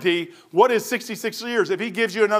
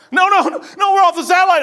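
high, loud, frantic cry; panicked shout; 10 semitones higher, 7 dB louder, faster than usual. Retold or imitated. Boss panicking that they're off the satellite. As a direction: yell it like everything is collapsing. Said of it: "He goes".

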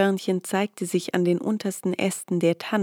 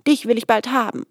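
louder, faster threat; determined shout; 4 semitones higher, 5 dB louder, faster than usual. A narrator's reading. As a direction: call out and lunge. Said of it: "rief er".

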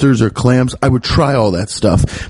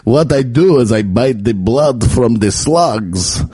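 slow vampire with Eastern European accent; Dracula; slower than usual. Shown as a demonstration. Comedian gives up on French, playing a slow hammed-up Dracula. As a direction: draw out the words as a hammy vampire. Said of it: "Now I'm actually doing Dracula".

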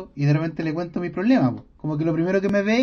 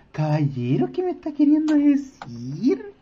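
baffled patient; 6 semitones higher, slower than usual. Retold or imitated. Acting the baffled patient's reply.

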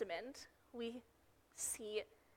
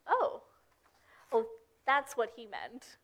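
dawning realization; 3 dB louder than usual. Retold or imitated. Disciples finally understanding what Jesus meant, dawning realization. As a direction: act out the penny dropping. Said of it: "that's when the disciples clued in".

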